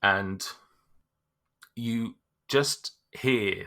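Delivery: ordinary talk, nothing marked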